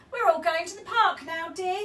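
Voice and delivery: loud, cheerful announcement; announcing. A narrator's reading. Husband bursting into bedroom, loud announcement. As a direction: boom it out proudly. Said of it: "he announced".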